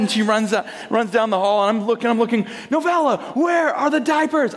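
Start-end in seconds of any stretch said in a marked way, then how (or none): 2.7-4.5 high-pitched exasperated call; frantic. Retold or imitated. Dad searching for diapers calls his daughter, acted frantic shout. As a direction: act out a desperate parent shouting down the hall. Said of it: "I'm, like, looking frantically".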